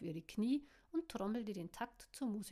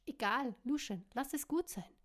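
louder, higher, firm cut-off; energetic; 4 semitones higher, 5 dB louder than usual. Acted. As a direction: cut him off with force. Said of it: "unterbrach ihn energisch".

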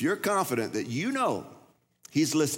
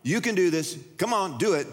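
dad calling up; 6 semitones higher than usual. Retold or imitated. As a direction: urge him on from the poolside. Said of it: "so he said".

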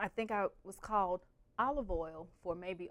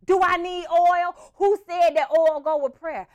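high, loud, slow indignant yell; angry shout; 9 semitones higher, 15 dB louder than usual. Retold or imitated. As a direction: shout back furious and wronged. Sister shouting back.

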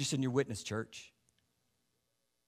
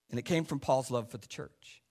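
normal talking voice

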